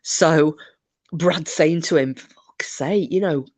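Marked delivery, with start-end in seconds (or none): 2.2-3.4 retold whisper. Telling Mike to keep quiet.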